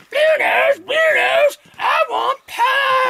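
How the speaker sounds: very high screeched demand, drawn out at the end; squealing, hammed-up scream for food. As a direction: screech it like a ravenous creature. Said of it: "she comes screaming through the poleyard".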